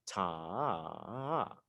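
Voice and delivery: long soft "Ta" with two extra swells; "Ta" stretched out, pitch bumping up twice; held TA with pulses. Shown as a demonstration. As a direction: sustain "Ta" over three beats, leaning on each. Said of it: "You put emphasis two extra times".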